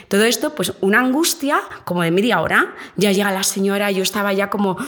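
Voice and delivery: ordinary talk, nothing marked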